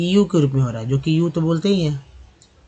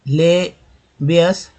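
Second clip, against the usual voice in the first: slow spelled-out reading; 3 semitones higher, 3 dB louder, much slower than usual. Shown as a demonstration. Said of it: "reading word list slowly".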